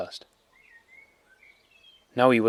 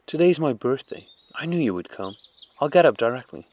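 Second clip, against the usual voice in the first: put-on higher, louder little-boy voice; a small boy bursting with news; excited child; 5 semitones higher, 5 dB louder than usual. A narrator's reading. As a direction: son greeting his mother.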